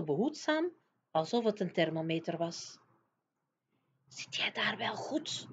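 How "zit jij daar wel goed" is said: slow, soft whispered check; hushed, drawn-out whispered question. A narrator's reading. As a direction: whisper it slowly, with hardly any voice. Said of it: "fluisterde hij".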